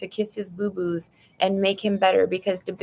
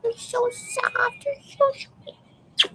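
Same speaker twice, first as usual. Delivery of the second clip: much higher, slow cooing pet voice; 13 semitones higher, much slower than usual. Real, not acted. Talking to her cat Nuggies, high slow cooing baby talk. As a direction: coo lovingly at a cat.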